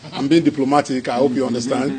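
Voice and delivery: ordinary talk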